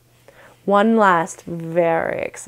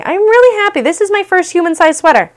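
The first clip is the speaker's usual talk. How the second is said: much higher, louder burst of joy; excited; 10 semitones higher, 5 dB louder than usual. Real, not acted. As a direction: say it again bursting with glee. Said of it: "I get to be excited".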